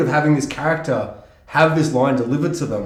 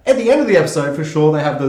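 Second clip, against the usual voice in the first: ordinary talk, nothing marked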